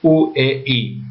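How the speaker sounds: ordinary talk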